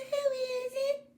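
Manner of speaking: very high, slow granny voice; a high, slow granny asking; old granny voice. Acted. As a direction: call out slowly like a frail grandma. Granny answers door.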